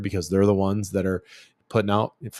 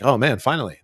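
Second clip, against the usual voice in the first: higher and louder, a burst of delight; mock thrill at a late-night Instagram find; excited shopper; 5 semitones higher, 5 dB louder, faster than usual. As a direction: blurt it like you've just found the perfect gadget.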